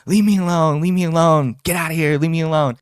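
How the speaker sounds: half-acted outburst, pushing him away; retold yelling. Storyteller imitating the girl yelling at him, loud and high. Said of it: "And she's like".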